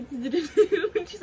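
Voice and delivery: slow, high laugh breaking into the words. Real, not acted. Laughing while telling viewers about the nurse. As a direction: laugh through it, can't hold it in.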